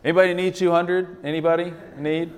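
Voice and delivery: playful mock server call; calling out to the room. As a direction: hawk the leftover order like a server, lightly. Staff calling out leftover sushi.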